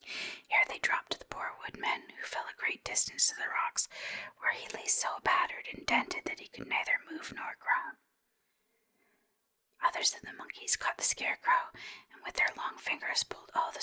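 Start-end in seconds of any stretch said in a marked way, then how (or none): none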